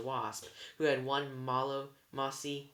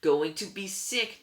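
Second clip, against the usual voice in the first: queasy warning; 7 semitones higher, 8 dB louder, slower than usual. A narrator's reading. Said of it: "Tyson warned".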